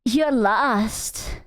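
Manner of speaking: breathy sultry whisper; mock ASMR role-play.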